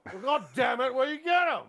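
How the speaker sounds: hammed-up drunken rant; drunk Brian Cox. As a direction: bellow it like a sloshed old actor. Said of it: "He's drunk again".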